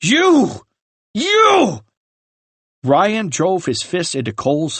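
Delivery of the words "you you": enraged scream. Acted. Ryan attacks Cole.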